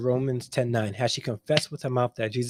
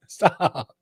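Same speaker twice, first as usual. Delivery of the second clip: higher-pitched laughing protest; 4 semitones higher than usual. Real, not acted. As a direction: crack up, then plead through the laugh. Cracking up at a chat comment.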